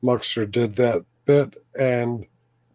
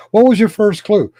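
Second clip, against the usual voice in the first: high, loud sarcastic quip; 8 semitones higher, 9 dB louder than usual. Real, not acted. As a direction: tease them with mock disbelief. Sarcastic reply after laughing.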